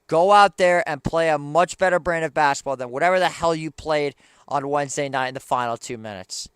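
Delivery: loud, furious demand; angry yelling. Real, not acted. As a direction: bark it at the team. Repeats demand, now yelling.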